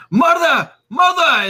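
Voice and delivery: high, loud cry for his mother; frantic shout. Acted. Son bursting into the room, shouting loud and high for his mother. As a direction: call out desperately for mother. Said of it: "He comes charging in".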